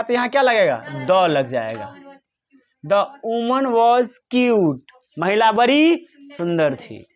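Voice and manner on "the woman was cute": slow dictation. Shown as a demonstration. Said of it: "teacher reads example slowly".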